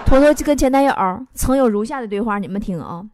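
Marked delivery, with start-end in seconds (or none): none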